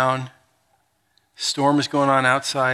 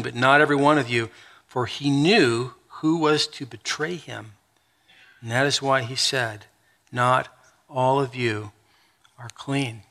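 scripture reading; 5 semitones lower, 3 dB quieter than usual. Acted. Pastor reading John thirteen to the congregation, solemn reading. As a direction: read the passage with reverent weight.

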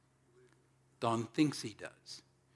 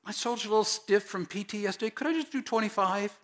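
pleading recruit; 9 semitones higher, 3 dB louder than usual. Pretend. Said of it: "What if Don said".

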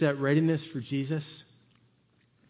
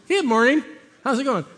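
cheery greeting; 9 semitones higher, 6 dB louder than usual. Retold or imitated. Cheery bystander greeting quoted.

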